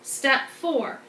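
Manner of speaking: usual speaking voice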